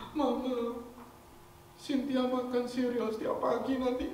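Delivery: put-on high tearful little girl voice; small child sobbing her fear; crying child. Cynthia telling her mother she will die, high tearful child.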